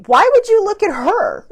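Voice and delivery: high, loud disbelieving outburst; retelling her own shocked reaction, high and loud; incredulous. Retold or imitated. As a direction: squeal it like you can't believe he did that. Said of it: "And I'm just like".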